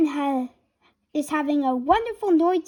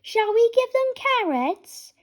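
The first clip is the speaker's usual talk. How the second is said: put-on much higher, bright child rabbit voice; high little rabbit asking eagerly; 10 semitones higher than usual. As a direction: pipe it up like a small bunny. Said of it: "cries Rebecca Rabbit".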